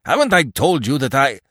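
high, loud angry protest cut off; angry demand. A narrator's reading. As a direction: protest furiously, then break off short. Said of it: "Fresnel demanded angrily".

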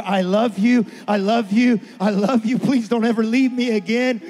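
loud, impassioned repeated love; fervent i love you. Retold or imitated. Preaching father telling his found daughter he loves her, loud and fervent. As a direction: pour it out as you hold her.

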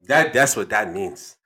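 put-on African accent with weighty pauses. Dad finishes explaining the proverb, African accent. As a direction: wrap up the lesson in an African accent. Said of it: "African accent".

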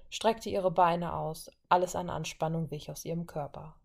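ordinary delivery, nothing marked

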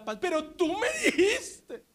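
high, slow whine with a half-laughing sob; whining child. Acted. (niño lloriqueando, agudo y lento)

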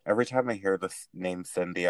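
ordinary talk

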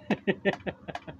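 high amused laughter. Real, not acted. Dad tickled by his daughter, laughing. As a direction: crack up at your own joke.

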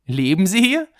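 "Leben Sie hier?" loud, high, climbing to the end; odd emphasis. A narrator's reading. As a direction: push "Leben Sie hier?" upward into an exaggerated question. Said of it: "mit einer seltsamen Betonung". („Leben Sie hier?“ seltsam betont, laut und hoch ansteigend)

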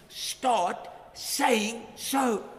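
slow, preached call to action; drawn out. Acted. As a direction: start saying so stretched very slowly. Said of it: "preaching climax".

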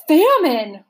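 high, loud anguished cry; anguished roar. Acted. The starving humbug, realizing what he ate, roars in anguish. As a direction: bellow it in despair. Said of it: "roared the anguished humbug".

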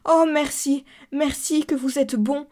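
high, loud burst of thanks; grateful exclamation. A narrator's reading. Cousin thanking the narrator for his promise, exclaiming gratefully. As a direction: cry out in overwhelming relief. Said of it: "Elle s'écria".

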